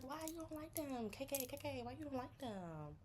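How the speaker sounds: high, prying voice; mock nosy friend. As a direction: pester them in a high voice. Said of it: "And you be like".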